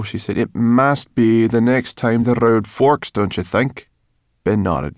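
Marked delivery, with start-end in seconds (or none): 0.4-3.9 louder and higher, drawn-out emphasis; slow, insistent push for agreement; impatient insistence. A narrator's reading. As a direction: press the point impatiently.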